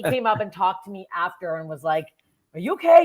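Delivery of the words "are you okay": high, loud concerned question; imitated concern. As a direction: check on someone, hammed up. Someone checks on nervous performer.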